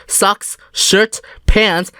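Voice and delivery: plain reading voice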